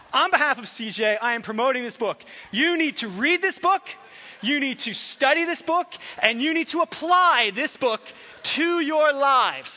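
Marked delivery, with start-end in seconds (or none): 2.5-9.7 loud, high, insistent exhortation; forceful urging. Real, not acted. Preacher urging congregation to buy the book, loud exhortation. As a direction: drive it home, pressing louder each line.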